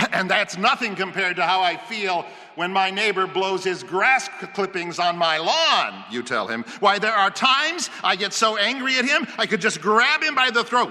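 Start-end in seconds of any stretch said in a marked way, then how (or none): 0.1-6.1 higher, louder indignant complaint; heated grievance. Acted. Homeowner complains to researcher about neighbor's clippings, heated. 6.8-10.9 high, loud acted outrage; worked-up fury. Homeowner so angry at his neighbor, fuming.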